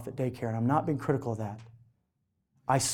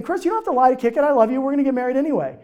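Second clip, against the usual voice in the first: wife; 9 semitones higher, 6 dB louder, faster than usual. Retold or imitated. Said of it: "she says the same thing".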